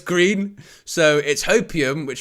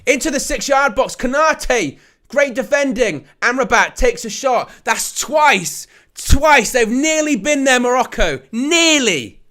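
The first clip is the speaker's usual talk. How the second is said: excited play-by-play, louder on the big moments; live match commentary; 7 semitones higher, 6 dB louder than usual. Acted.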